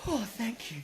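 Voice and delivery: high, very soft mum impression; mock gratitude in a tiny high voice; grateful mum. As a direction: coo it softly through a laugh. Mum lying there, joke quote.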